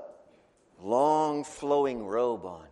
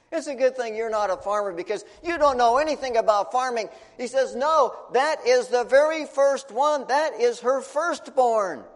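exasperated retort; 9 semitones higher, 7 dB louder than usual. Acted. Dad scolds the priest who knows nothing of farming, fed up and loud. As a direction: set the know-nothing straight.

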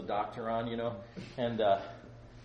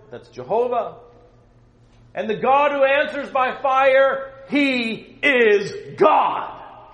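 booming prophet challenge; 12 semitones higher, 15 dB louder, slower than usual. Acted. Preacher voicing Elijah's challenge.

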